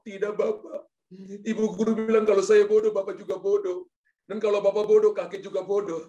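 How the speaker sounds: high, tearful child voice, hammed-up; Yakobus. Son reporting teacher's insult to father, mock crying. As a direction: whimper the complaint like a crying schoolboy. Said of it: "kenapa kamu nangis".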